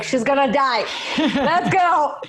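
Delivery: panicked mom. Retold or imitated. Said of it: "my mom was freaking out so much".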